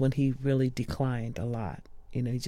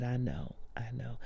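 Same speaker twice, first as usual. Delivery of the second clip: softer, tender reassurance; tender comfort; 11 dB quieter than usual. Retold or imitated. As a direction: soothe him gently, fading. Comforting dying friend.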